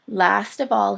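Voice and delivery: plain reading voice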